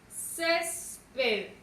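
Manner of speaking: syllable-by-syllable "Césped", weight on the first; stressed first syllable. Shown as a demonstration. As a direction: stretch CÉS in "Césped", then let PED fall. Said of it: "Lleva su sílaba tónica en la".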